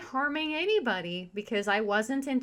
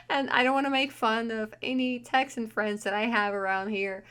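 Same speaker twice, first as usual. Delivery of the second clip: usual speaking voice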